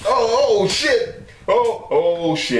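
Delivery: high alarmed cry of oh shit; panicked high squeal; startled yelp. Real, not acted. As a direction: yelp it as the head comes out. Snake head emerging from log.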